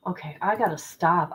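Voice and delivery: usual speaking voice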